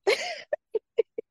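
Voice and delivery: squealing nervous laugh; high-pitched giggle burst. Real, not acted. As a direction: laugh in disbelief.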